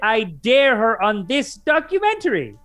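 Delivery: loud, high, hammed-up defiant dare; cocky. Retelling a lawyer's on-camera dare to his accuser, mock cocky. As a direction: crow the challenge, drawing it out. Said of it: "he's so cocky".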